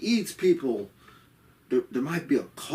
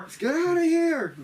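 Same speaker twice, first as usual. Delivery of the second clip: panicked. Retold or imitated. Crew panicking, fleeing the man.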